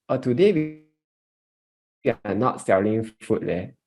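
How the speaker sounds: usual speaking voice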